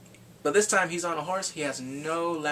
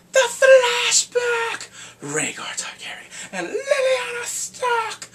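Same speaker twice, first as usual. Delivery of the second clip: high, drawn-out squealing announcement; ecstatic shout; 9 dB louder, slower than usual. Real, not acted. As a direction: stretch the names out in loud fangirl excitement. Ecstatic announcing of a big scene.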